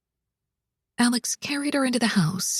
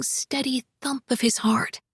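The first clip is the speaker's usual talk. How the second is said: usual speaking voice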